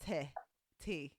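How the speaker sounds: 'te' for 'two', vowel opened to eh. Shown as a demonstration. Friend demonstrating how the accent says two, 'te' with an eh vowel. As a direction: say 'te' with a short open e. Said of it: "the two is like te".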